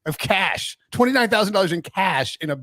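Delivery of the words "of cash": ordinary delivery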